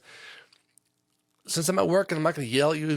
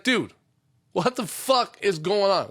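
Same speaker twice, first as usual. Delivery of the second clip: raised, drawn-out exasperated demand; mock parent losing patience, voice pitched up; exasperated scolding; 5 semitones higher, slower than usual. Parent to own child who keeps messing up, exasperated scolding.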